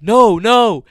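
panicked no. Retold or imitated. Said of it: "Anna's like".

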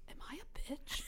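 mock-timid hushed question; insecure whisper. Friend coming up to her for reassurance, insecure whisper. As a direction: whisper it, imitating a worried friend.

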